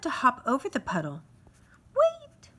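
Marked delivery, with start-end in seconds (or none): 1.9-2.5 sudden high, loud tiny-creature cry. Acted. Tiny mosquito calls up to stop Grasshopper, high and loud. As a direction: squeak it out like a little insect calling up. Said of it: "cried a tiny voice".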